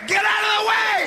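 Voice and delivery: high mock yell at the kid; grandma yelling. Grandma yelling at the kid she backed into, high yell. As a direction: snap at the boy to move. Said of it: "what did she say".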